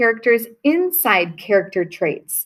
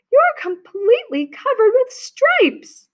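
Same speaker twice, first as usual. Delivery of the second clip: much higher, horrified exclamation; a mother aghast at what she sees; pitched way up in shock; alarmed cry; 13 semitones higher than usual. Acted. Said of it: "she cried".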